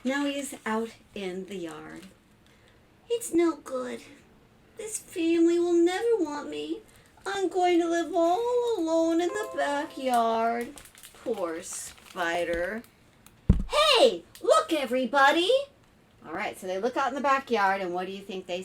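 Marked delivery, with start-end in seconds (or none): 3.1-10.7 higher, plaintive storybook spider voice; dejected little spider. Acted. Spider rejected by the family, plaintive lament. 13.7-15.5 high, loud storybook call; excited calling out. Acted. Someone calling the family to the backyard, excited shout. Family member calls the others over.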